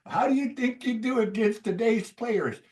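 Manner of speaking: high put-on interviewer voice; mock the reporter's question; interviewer asking. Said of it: "they ask him, like".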